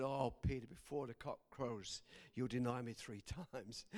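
gentle foretelling. Acted. Jesus answers Peter's vow, gentle and low. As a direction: say it softly to a friend who will fail.

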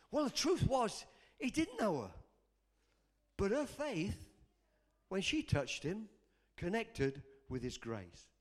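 normal talking voice

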